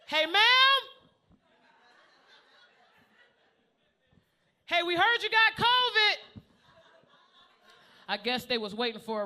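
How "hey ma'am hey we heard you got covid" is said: high, loud calling through the door; mock paramedic call. Paramedics calling through cracked door, mock loud. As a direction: call into the house, hammed up. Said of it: "They said".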